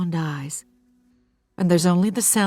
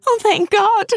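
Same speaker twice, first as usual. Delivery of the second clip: high, breathless relief; frightened mother relieved at the door; Hannah, relieved; 7 dB louder than usual. Acted. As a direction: gasp out thanks, pitched high.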